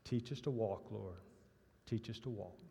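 hushed, deep-voiced churchgoer asking the Lord. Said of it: "a deep voice said".

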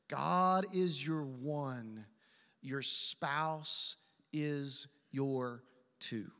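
very slow, spaced-out words; deliberate key-point delivery. Real, not acted. Preacher repeats the point for note-takers, slow and deliberate. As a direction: drive the point home word by word, slowly.

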